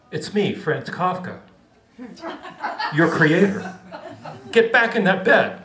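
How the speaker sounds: a hint of a bossy author; lightly voiced stern writer, half-laughing. Kafka voiced in story.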